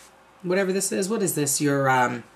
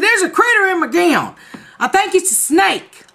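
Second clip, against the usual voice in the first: higher, urgent and frightened; panicked; 9 semitones higher than usual. Retold or imitated. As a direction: sound terrified of the snake. Panicked telling husband.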